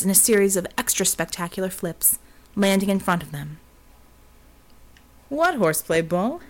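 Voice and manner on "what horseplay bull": mocking. A narrator's reading. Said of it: "more than a hint of mockery in his voice".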